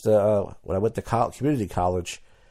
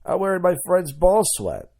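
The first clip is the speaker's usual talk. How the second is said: much higher, slow, proud announcement; proud boast; 10 semitones higher, 4 dB louder than usual. Retold or imitated. Guy telling a girl what he wears, high proud boast. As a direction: announce it like a prize. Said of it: "You go".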